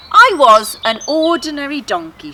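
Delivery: high, loud storytelling donkey. Acted. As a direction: announce it as the grand donkey. Donkey begins her tale.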